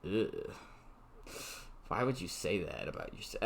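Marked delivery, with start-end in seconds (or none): none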